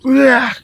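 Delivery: short high-pitched "wah" yell; demonstrated yell. Shown as a demonstration. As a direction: let out a quick demo shout.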